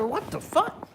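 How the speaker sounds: high, startled what the fuck; disbelieving yelp, way up high; shocked outburst. Retold or imitated. Telling the therapist off in a high shocked yelp.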